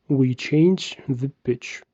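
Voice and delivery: normal talking voice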